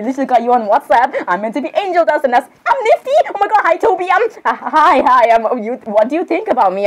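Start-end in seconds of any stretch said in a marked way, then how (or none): none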